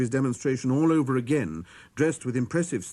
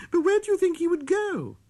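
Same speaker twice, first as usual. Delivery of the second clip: put-on high, louder amused child's question; little prince voice; 4 dB louder than usual. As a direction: ask it laughing, like a small boy.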